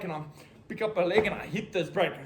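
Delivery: usual speaking voice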